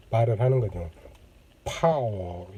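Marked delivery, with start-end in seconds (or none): 1.6-2.5 slow, low American "파워" pushed out on the breath; American pronunciation. Shown as a demonstration. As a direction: open up the mouth for a deep American "파워". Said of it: "몸속에서 나오는 호흡을 뱉으면서".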